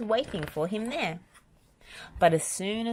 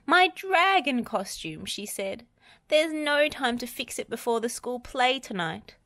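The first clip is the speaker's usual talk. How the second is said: dismayed Missus Percival; 8 semitones higher than usual. A narrator's reading. Missus Percival laments the torn costume before the play, dismayed.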